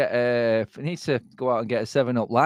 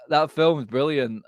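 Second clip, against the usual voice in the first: ordinary talk, nothing marked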